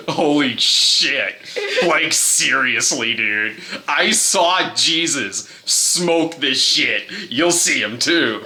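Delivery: drawn-out, awed stoner; spaced-out dude spreading the word; stoner hippie disciple. Acted. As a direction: stretch it out like a blissed-out hippie.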